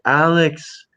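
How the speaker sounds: lightly voiced concerned friend filming; imitating concerned friend. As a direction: play the worried buddy checking in. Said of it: "he's like".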